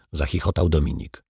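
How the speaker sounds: usual speaking voice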